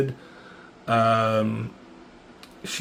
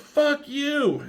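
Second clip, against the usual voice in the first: retold defiant yell; 14 semitones higher than usual. Pinned fighter refusing to yield, high mock yell. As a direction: squeal the refusal in a put-on high pitch. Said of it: "Tora is yelling at Xander to yield".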